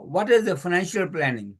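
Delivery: ordinary talk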